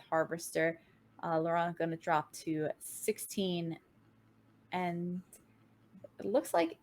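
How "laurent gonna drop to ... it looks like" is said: usual speaking voice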